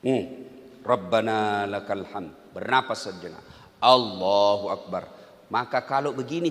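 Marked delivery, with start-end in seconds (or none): 0.9-2.5 low, slow prayer chant; slow recitation. Shown as a demonstration. Preacher showing the congregation how to recite. 3.8-5.1 low, slow, drawn-out Allahu Akbar; drawn-out takbir. Shown as a demonstration. Preacher demonstrating prayer words to congregation.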